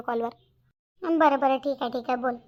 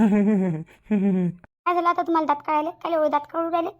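annoyed; 4 dB louder, slower than usual. Acted. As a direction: drag it out, pretending to be offended. Reacts to his laughing. (चिडून, हळू, लटक्या रागात)

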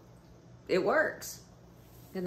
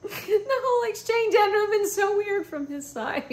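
high, louder laughing voice; 13 semitones higher, 5 dB louder than usual. Real, not acted. Telling viewers, high laughing. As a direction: squeal it through laughter. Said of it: "still laughing".

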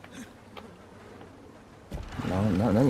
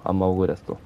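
ordinary talk, nothing marked

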